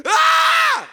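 a short, piercing shriek, very high and loud; startled full-throat scream. Retold or imitated. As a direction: scream in terror at the temptation. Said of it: "I literally screamed out loud".

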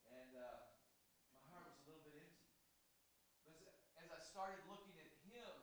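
far from the microphone, speaking up; off mic. Real, not acted. Preacher speaking to the congregation without a microphone, far off. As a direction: step away from the mic and project to the room. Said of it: "And I won't use this. I'll just talk loud".

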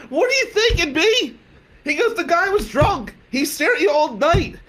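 high, mocking buddy voice; high-pitched friend voice. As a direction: play the friend scolding you. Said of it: "And Q's like".

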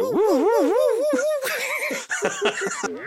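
very high, loud mock whooshing woo; comic high-pitched woo-woo sound effect; imitated kick sound effect. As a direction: hoot a silly high kicking sound effect. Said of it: "kicking sound effect, which is sort of like".

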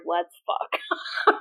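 breathy whispered invitation, barely voiced; hushed whisper. Claire whispering to Jamie on their wedding night. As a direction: drop to an intimate whisper.

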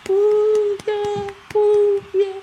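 very high, loud falsetto whoops; cheering. Pretend. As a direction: whoop like a squealing fan. Cheering a friend's new job.

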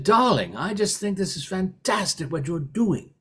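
a lightly put-on English accent, fondly; mock-English darling, done badly on purpose; a half-hearted English accent. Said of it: "and I do it badly".

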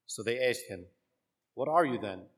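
measured liturgical proclamation; solemn church reading voice; gospel reading. Acted.

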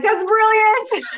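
high, slow, laughing amazement; laughing mock awe. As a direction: gush through laughter. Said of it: "he's gonna be like".

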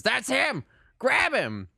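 high, loud accusing yell; shouting. Acted. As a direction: shout to alert the guards. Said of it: "the guy from the bar goes".

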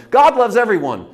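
loud, high preachy proclamation; mock pulpit proclamation. Mocking the church proclaiming from the platform. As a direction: sell it like a showy preacher.

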